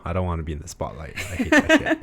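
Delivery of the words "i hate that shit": high laughing outburst. Real, not acted. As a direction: laugh it out, pitched up. Joking about hating spotlight.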